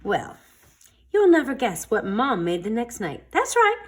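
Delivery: plain reading voice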